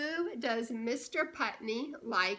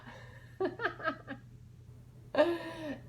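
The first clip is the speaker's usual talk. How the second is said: laughing; 5 semitones higher than usual. Real, not acted. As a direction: giggle.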